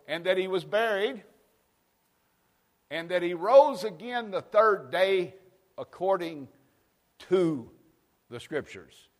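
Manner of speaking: fiery pulpit reading; preaching at full force. Acted. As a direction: proclaim each word to the congregation.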